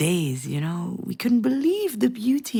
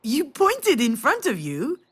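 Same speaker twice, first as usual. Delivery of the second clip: ordinary delivery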